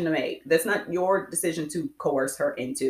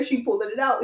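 usual speaking voice

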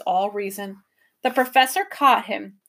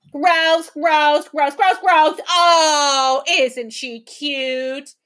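higher, louder mocking sneer; sneering creatures; 4 semitones higher, 5 dB louder, much slower than usual. A narrator's reading. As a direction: simper and mock the girl. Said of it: "simpered and sneered".

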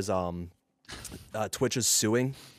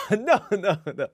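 high, loud no through laughter; laughing denial; 11 semitones higher, 6 dB louder than usual. Real, not acted. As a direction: laugh off the suggestion. Laughing off question about breaking down.

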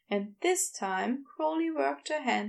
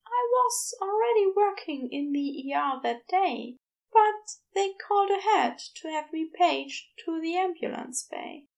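higher, gentle Aziraphale explaining; higher Aziraphale telling Crowley what happened; 6 semitones higher, 4 dB louder than usual. A narrator's reading. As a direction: raise the pitch and soften the bad news. Aziraphale recounts.

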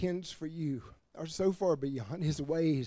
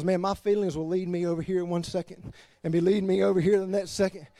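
ordinary delivery

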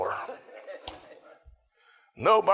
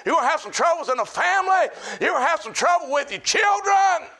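fiery preacher hammering the warning; preaching at full force; 8 semitones higher than usual. Real, not acted.

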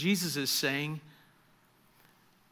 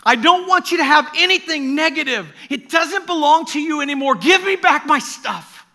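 intense demand, not angry; 11 semitones higher, 15 dB louder than usual. Retold or imitated. Jesus to Graham in a dream, loud intense plea. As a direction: demand it back with love, not rage. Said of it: "Remember, not anger in the voice".

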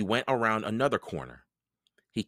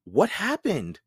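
lightly voiced little girl, higher; a girl's worried question; curious little sister asking in the dark; young Annie; 7 semitones higher, 4 dB louder, slower than usual. A narrator's reading.

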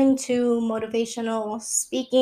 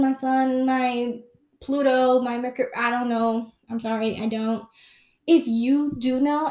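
usual speaking voice

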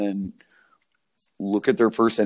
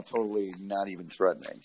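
ordinary delivery

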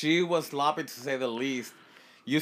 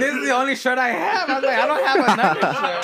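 mock loud protest; 8 semitones higher, 7 dB louder, faster than usual.

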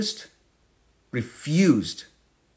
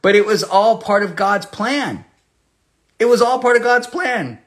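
emphatic preaching; 9 semitones higher, 5 dB louder than usual. Real, not acted.

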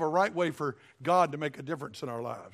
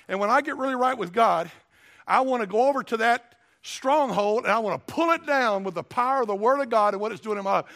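loud, driving pulpit preaching; louder full-force sermon delivery; fervent preaching; 4 semitones higher, 6 dB louder than usual. Acted. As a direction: preach it hard to the congregation.

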